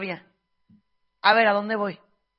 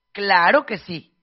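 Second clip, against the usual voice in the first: louder, slower sarcastic claro que sí, stretched out; loud slow sarcasm; 2 semitones lower, 9 dB louder, slower than usual. Real, not acted. To her audience, a loud slow sarcastic claro que sí.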